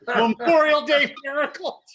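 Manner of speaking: high, slow, laughing repeat; laughing echo. Real, not acted. To the friends at the table, echoing the joke with a laugh. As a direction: savour the phrase through a laugh.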